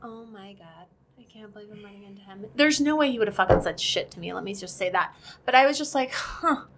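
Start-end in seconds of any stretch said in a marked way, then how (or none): 0.0-2.5 very quiet, quick aside to herself; retold panic under the breath; hushed inner shock. Retells her silent shock seeing ex. 6.1-6.6 small breath of surprise, no voice; higher, airy little reaction; retold stunned huh. Retells her stunned reaction to ex.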